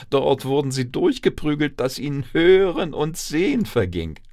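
high, loud, breakneck-adventure telling; agitated storyteller at the climax; dramatic narration. A narrator's reading. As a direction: narrate the tumbling with mounting drama. Mice tumbled through harvester.

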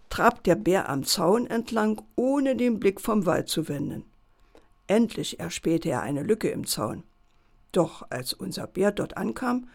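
plain reading voice

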